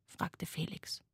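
ordinary delivery

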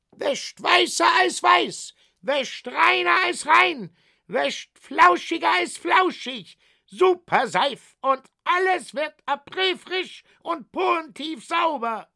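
high, loud fairground sales shout; hawker shouting pitch. Acted. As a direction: sell soap at full volume to the crowd. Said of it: "schrie der Jahrmarktverkäufer".

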